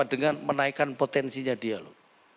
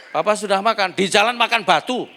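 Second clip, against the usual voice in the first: mock sarcastic husband; 7 semitones higher, 8 dB louder than usual. Rude husband-wife exchange retold.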